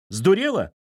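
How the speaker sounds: high alarmed shout. Acted. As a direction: yell it at her in shock. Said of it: "закричали за спиной". (возмущённый выкрик)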